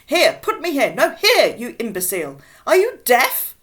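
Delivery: high, loud exasperated scolding; irate ordering. Acted. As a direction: shout orders at incompetent helpers. Irate woman ordering firemen about.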